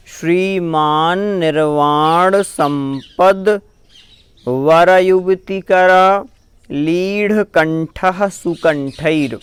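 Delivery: measured, syllable by syllable chant-like reading; slow Sanskrit recitation. Shown as a demonstration. Teacher reciting puja text for students, slow and careful. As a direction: recite each word clearly for the learners. Recites whole line again.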